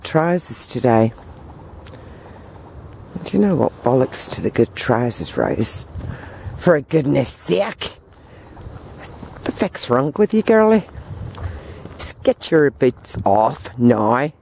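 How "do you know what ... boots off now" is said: mock Northern Irish sister scolding; Northern Irish accent. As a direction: imitate a blunt Northern Irish relative telling her off. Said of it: "that's how they talk".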